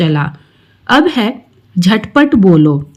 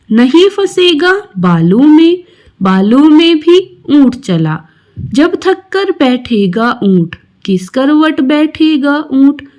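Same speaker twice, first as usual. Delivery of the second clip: put-on raised, sing-song recital of a children's poem; poem recitation; 6 semitones higher than usual. Teacher reciting a poem to her pupils, raised and lilting. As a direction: recite it brightly for young pupils.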